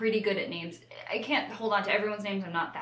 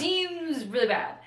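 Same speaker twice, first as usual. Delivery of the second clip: mock ominous; 9 semitones higher, 4 dB louder, slower than usual.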